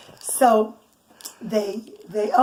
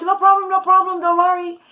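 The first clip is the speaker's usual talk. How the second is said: high, louder, cheerful reassurance; hearty villagers; 7 semitones higher, 7 dB louder than usual. Acted. Villagers to the sorry guest, cheerful loud reassurance. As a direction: wave off his apology warmly, loud and high.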